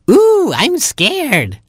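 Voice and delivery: very high, slow mock-scared squeak; thrilled tiny chick pretending fright. Baby chick enjoying the scare, very high and slow.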